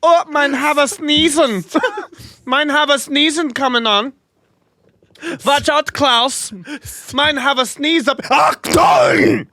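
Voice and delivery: hammed-up German sneezing man, very high; a thick mock German accent in a squeaky voice; silly cartoon German accent. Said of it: "that fucking German accent".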